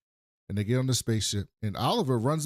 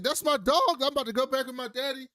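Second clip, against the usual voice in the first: much higher, giddy and quick; mock eager Will; 12 semitones higher than usual. Will bragging about leaving with his dad, high and giddy. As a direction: gush like a kid whose dad came back. Will mocked in Fresh Prince scene.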